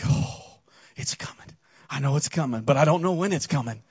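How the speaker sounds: mock-nervous murmur waiting for the drop; hushed dread. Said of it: "you're like".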